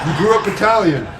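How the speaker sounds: loud, laughing call-out; laughing shout. Real, not acted. Host teasing the guest about her roots, laughing loudly.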